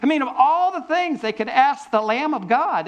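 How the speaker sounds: congregation-facing incredulous comment on the disciples; amused disbelief. Real, not acted. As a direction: marvel at how odd the question is.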